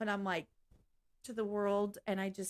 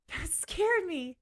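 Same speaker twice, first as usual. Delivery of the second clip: higher, slow startled line; much higher, drawn-out startled remark; 7 semitones higher, slower than usual. Real, not acted. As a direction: shaken after a sudden fright. Startled reaction.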